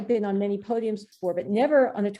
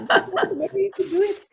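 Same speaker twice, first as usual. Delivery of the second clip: high, hurried mock French accent; hammed-up French official, high and loud; bad French accent; 10 semitones higher, 5 dB louder than usual. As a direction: correct someone urgently in a bad French accent. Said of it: "bad French accent".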